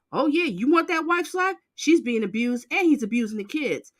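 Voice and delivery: ordinary talk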